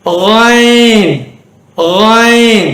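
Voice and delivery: ஐன் as a long Arabic 'ghayn'; lightly gargle a gh into ஐன்; light throaty gh. Shown as a demonstration. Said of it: "லைட்டா".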